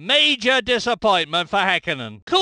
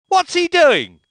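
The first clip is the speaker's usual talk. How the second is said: startled disbelief; 6 semitones higher, 5 dB louder than usual. Acted. Car stopped on the grid.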